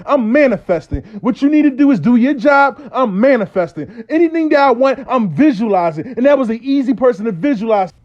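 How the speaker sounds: hammed-up angry buddy; heated mock rant. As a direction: fire back defensive and worked up. [name] snaps back defensively.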